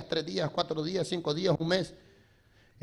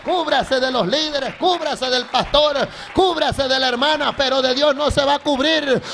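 high, driving sermon voice; full-force preaching; 5 semitones higher, 5 dB louder than usual. Acted. Preacher warning none can hide from God, full-force preaching. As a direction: hammer out each line to the congregation.

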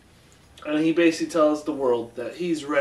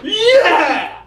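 high, loud re-enacted "yeah" with a laugh; exultant fan yell, played back laughing; retold triumphant cheer; 10 dB louder than usual. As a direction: cheer it out like a fist pump. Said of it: "I threw my fist into the air".

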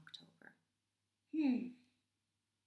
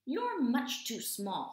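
snapping cat; 5 semitones higher, 8 dB louder, slower than usual. Acted. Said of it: "snapped the cat".

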